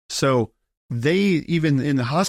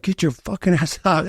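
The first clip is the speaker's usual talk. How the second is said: higher-pitched mock angry order; pissed-off retelling; 6 semitones higher than usual. Wife ordering stubborn husband to hospital, retold pissed off. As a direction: imitate an angry wife without raising volume. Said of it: "And she was pissed".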